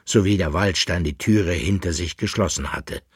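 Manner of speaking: plain reading voice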